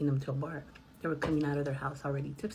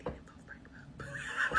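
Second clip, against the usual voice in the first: secret whisper; 8 dB quieter than usual. Retold or imitated. Passenger whispers his secret to the driver. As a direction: whisper it like a confession. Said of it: "then he whispers to me".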